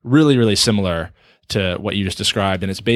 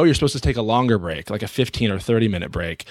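normal talking voice

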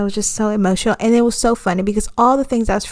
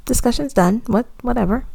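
lightly imitated boss, higher-pitched; a hint of an unconcerned boss; dismissive boss; 5 semitones higher, 3 dB quieter than usual. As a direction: wave it off in a high, unbothered voice. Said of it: "my boss was like".

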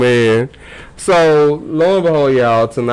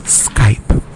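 whispered, drawn-out name with crisp consonants; ASMR whisper; 12 semitones higher, 3 dB quieter, much slower than usual. Pretend. Podcast host teasing Skype into the mic, ASMR whisper. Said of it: "A S M R now".

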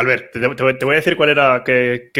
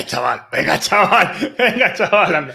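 teasing put-on voice; 7 semitones higher than usual.

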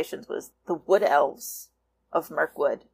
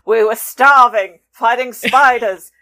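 loud, raised cartoon dwarf protest; Thorin; 5 semitones higher, 12 dB louder than usual. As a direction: plead loudly in a put-on character voice, dragging out the last words. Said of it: "when Thorin says".

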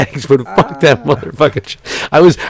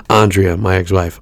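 ordinary delivery

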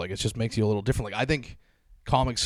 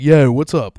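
higher, loud Baltimore "Yo"; Baltimore accent; 5 semitones higher, 14 dB louder, slower than usual. Shown as a demonstration. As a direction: exaggerate the fronted Baltimore O in "Yo". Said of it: "It'd be like".

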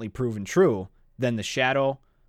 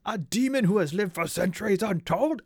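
dragon voice; 6 semitones higher than usual. Retold or imitated. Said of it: "the whole time he's going".

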